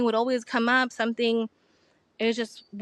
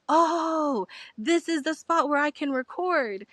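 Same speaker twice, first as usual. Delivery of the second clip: sudden realization; 5 semitones higher, slower than usual. Retold or imitated. Realizing the spot is right.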